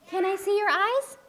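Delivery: usual speaking voice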